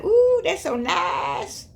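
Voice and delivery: high, slow, syrupy "ooh, that's so nice"; mock sweet gushing. Someone charmed by a smiling devil, cooing in slow mock delight. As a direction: coo it slowly, charmed by the devil's smile. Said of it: "And you're like".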